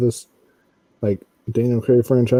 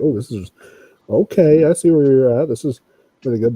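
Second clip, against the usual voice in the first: higher, louder dawning approval; pleasantly impressed; 5 semitones higher, 6 dB louder than usual. Retold or imitated. Fan recalling his first look at Casino Royale, pleasantly impressed. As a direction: won over, mid-realization.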